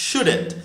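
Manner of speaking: "shouldn't" pitched high, then falling; short form "shouldn't". Shown as a demonstration. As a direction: model "shouldn't" as one contracted word. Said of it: "আবার বলছি".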